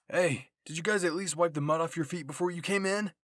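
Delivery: loud scolding call across the cabin; Aaron yells at the returning squad about muddy feet, loud; yelling. A narrator's reading. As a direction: shout it at them as they come in. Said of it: "Aaron yelled".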